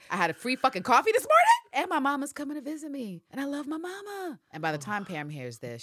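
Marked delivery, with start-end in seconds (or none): 0.1-4.4 giddy bragging. Retold or imitated. Fernanda brags about her life.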